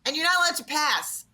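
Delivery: high-pitched exasperated telling-off; acted indignant protest; exasperated scolding. Acted scolding of dad over role.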